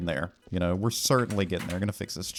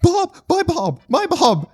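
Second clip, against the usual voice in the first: loud, very high falsetto shrieks; hysterical calling; 8 dB louder than usual. Pretend. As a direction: shriek the name in mock shock.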